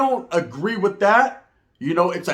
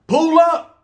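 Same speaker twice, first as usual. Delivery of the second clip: slang catchphrase; 10 semitones higher, 6 dB louder than usual. Shown as a demonstration. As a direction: pull up called out high and loud. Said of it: "Pull up is their favorite word to use nowadays".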